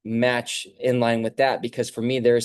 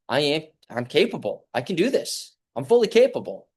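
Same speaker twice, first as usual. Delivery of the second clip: building confidence; 4 semitones higher than usual. Acted. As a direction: build each claim higher until it rings out. Affirming self-belief, building up.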